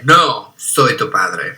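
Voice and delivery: lightly acted film line, dropping low at the end; a touch of villain's solemn reveal; Darth Vader. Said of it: "Al parecer Darth Vader dice".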